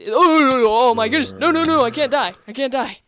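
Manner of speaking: real panic, begging not to die; freaking out. Real, not acted. Gamer attacked by a zombie, panicked yell. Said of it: "like, freaking out here".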